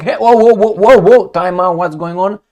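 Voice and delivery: loud, high alarmed "whoa, whoa"; mock panic, loud and high; alarmed objection. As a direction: throw up your hands and call time out loudly. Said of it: "Nobody will be like".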